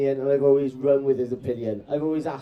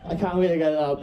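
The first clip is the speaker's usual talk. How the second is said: usual speaking voice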